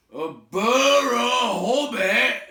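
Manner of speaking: high, loud, slow troll echo with a laugh; startled trolls. Acted. Trolls startled by the word hobbit, echoing it. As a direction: repeat it dumbfounded. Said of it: "a bit startled".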